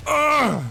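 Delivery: a real near-tearful whoop; overjoyed cry. Real, not acted. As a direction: let out a yelp of overwhelmed delight. Long-sought combo finally works.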